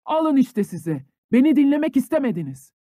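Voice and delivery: high, loud furious yell; shouting at the top of his lungs. A narrator's reading. Ilya yelling at the household after smashing the glass. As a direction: scream it out after smashing the window. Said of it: "avazı çıktığı kadar bağırdı".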